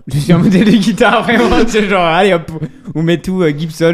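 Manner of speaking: high, loud burst of laughing disbelief; amused mocking. Real, not acted. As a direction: crack up as you speak. Laughs at the question's opening.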